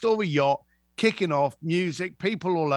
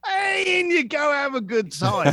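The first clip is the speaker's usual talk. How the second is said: much higher, loud cheerful welcome; cheery shout; 8 semitones higher, 4 dB louder than usual. Retold or imitated. Doorman greeting the guest who knew the password, loud and bright. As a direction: throw the door open with a happy shout.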